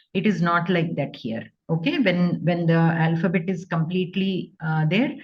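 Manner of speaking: ordinary delivery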